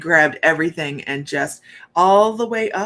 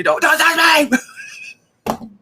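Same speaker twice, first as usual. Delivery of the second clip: pained plea; 9 semitones higher, 3 dB louder, much slower than usual. Retold or imitated. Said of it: "I'm going".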